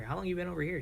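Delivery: ordinary delivery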